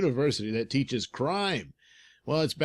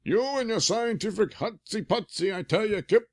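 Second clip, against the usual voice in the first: put-on higher, loud old sergeant; gruff old cop; 9 semitones higher, 3 dB louder than usual. Sergeant McGinty speaks.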